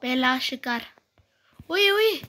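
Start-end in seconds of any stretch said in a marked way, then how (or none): 1.7-2.1 high, loud cry of alarm; startled yelp. Real, not acted. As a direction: yelp as if caught off guard. Startled by attack while sniping. (चौंकी हुई तेज़ आवाज़)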